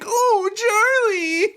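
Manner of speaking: very high, drawn-out squealed call; dog-ferret creature voice. Acted. Weird dog-ferret creature calls to Charlie, high squeal. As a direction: squeal it like a weird talking pet. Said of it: "a perfect impression of him".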